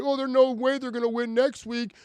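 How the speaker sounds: high, mocking whine of a gloomy fan; mocking doom fan. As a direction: mimic the doom-and-gloom fans. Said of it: "I'm like".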